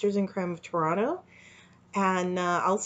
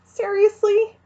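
disbelieving; 6 dB louder, slower than usual. A narrator's reading. Exhausted disbelief.

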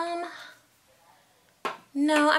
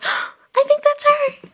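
gasp, then high, loud and slow; thrilled she's finally arrived; excited gasp; 13 semitones higher, 9 dB louder, slower than usual. Acted. Barbie hears the guest arrive.

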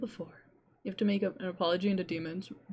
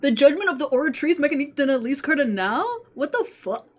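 surprised; 7 semitones higher, 8 dB louder than usual. Real, not acted. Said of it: "streamer reacts reading".

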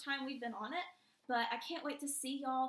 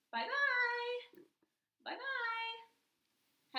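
higher, louder sing-song bye-bye; sing-song goodbye; 11 semitones higher, 5 dB louder, much slower than usual. Real, not acted. Teacher waving bye to students with her dog, high sing-song voice.

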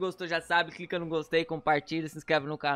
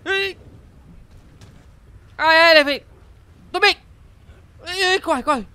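being chased; 8 semitones higher than usual. Real, not acted. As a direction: yell while running for your life. Monster chases him in game.

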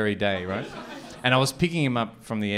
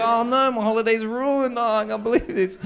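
higher, mock despair at ruined holiday; 12 semitones higher than usual. Comic mate groaning that his holiday is ruined, hammed-up despair. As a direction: whine like the trip is over. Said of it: "He's like".

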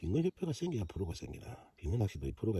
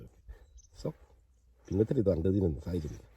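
ordinary talk, nothing marked

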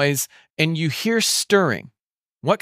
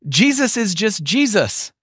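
ordinary talk

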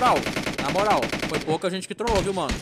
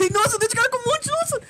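high shrieks between laughs; panicked laughing. Real, not acted. Runs into zombie horde.

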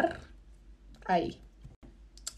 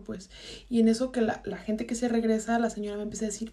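plain reading voice

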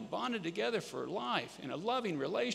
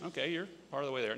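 ordinary delivery

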